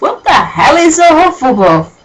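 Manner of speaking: high, loud mock disbelief; baffled outburst at the text; incredulous. Real, not acted. Reader mocks the text.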